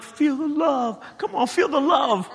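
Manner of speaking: high, slow, drawn-out plea to feel it; mock desperate high plea; desperate pleading. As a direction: beg yourself to feel the love, half laughing. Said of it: "I'm like".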